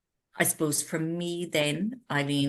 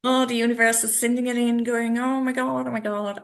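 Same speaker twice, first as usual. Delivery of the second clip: high, hurried exclamation; hammed-up fluster, pitched up; mock overwhelm; 7 semitones higher, 5 dB louder than usual. Retreat founder retelling how fast it came together, mock overwhelm. Acting out her overwhelmed reaction.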